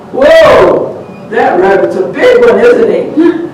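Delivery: high, loud whoa; surprised boys. Acted. Boys surprised at how big the rabbit is.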